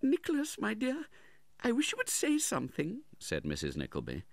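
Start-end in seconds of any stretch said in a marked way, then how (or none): 0.0-3.0 very high woman's voice; pleading mother. A narrator's reading. Mother prompts her silent son, pleading. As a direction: coax your son, pitched far up.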